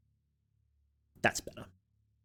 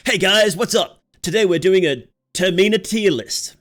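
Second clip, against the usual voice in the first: loud, high, hyped YouTube intro; big energetic clickbait host greeting; YouTuber voice; 7 semitones higher, 8 dB louder than usual. Shown as a demonstration. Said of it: "let me get my YouTuber voice on".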